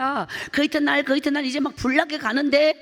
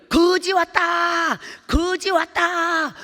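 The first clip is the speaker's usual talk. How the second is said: put-on slow, loud taunting shout of a boy; mocking shout; 5 semitones higher, 3 dB louder, much slower than usual. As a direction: yell it out to the whole yard, slow and jeering. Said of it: "이렇게 소리를 막, 막 불러".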